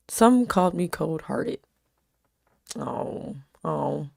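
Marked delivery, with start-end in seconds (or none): none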